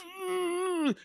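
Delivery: high, soft strained whine; straining groan. Acted. Henchman heaving a body into a grave, straining. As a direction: fake effort noise, squeezed high.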